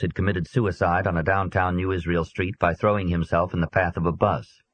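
usual speaking voice

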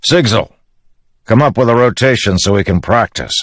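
put-on gruffly practical old soldier giving orders; Teft. Teft answers Dalinar and organises practice, grudging.

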